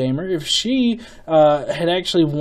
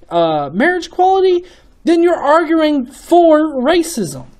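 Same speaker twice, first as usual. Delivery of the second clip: exasperated rant; 9 semitones higher than usual. Real, not acted. Ranting about civil rights.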